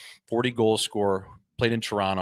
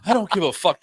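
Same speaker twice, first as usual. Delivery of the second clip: dismissive ref; 8 semitones higher, 5 dB louder than usual. Retold or imitated. Retelling ref's dismissive line.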